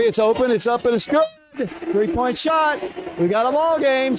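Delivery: rising game-on excitement; excited call. Real, not acted. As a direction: sell the comeback three. Comeback three hits.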